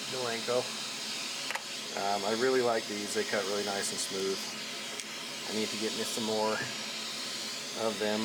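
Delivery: talking up over the machine noise; over vacuum noise. Real, not acted. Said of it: "you'll have to excuse the vacuum noise".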